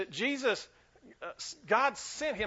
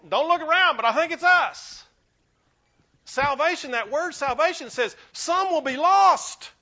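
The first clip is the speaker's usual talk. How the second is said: much louder, higher, driving pulpit voice; full-force preaching; 4 semitones higher, 10 dB louder than usual. Acted. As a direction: hammer it home to the church.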